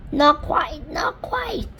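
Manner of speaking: high whispered correction. Acted. Miss Spider correcting them, high whisper. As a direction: hush it high.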